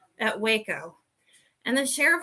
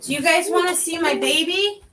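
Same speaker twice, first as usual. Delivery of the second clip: higher, eager offer to the audience; excited to show; 7 semitones higher than usual. Real, not acted. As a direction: perk up proudly about the puppy. Showing off her dog.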